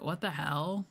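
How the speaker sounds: ordinary delivery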